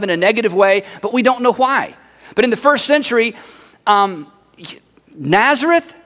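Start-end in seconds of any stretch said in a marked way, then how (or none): 5.2-5.9 higher, rising, doubting question; skeptical. Acted. Nathanael reacts to the word Nazareth, skeptical. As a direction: echo the town in disbelief. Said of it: "Nathanael is a little bit skeptical".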